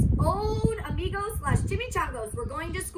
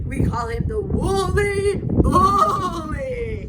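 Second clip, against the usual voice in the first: very slow, louder dramatic voice; ominous naming; 4 semitones higher, 8 dB louder, much slower than usual. Acted. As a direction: draw out the bully's name ominously. Naming the feared bully.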